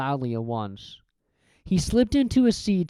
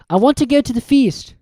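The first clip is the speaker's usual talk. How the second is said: a hint of a raised boy's voice; hopeful request; Harry's voice; 9 semitones higher, 7 dB louder than usual.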